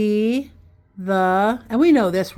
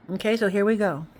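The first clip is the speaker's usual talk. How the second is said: ordinary talk, nothing marked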